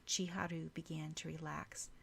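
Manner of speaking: usual speaking voice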